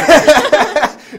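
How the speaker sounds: burst of laughter. Real, not acted. Teacher amused by a student's answer, laughing out loud. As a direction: break out laughing mid-praise.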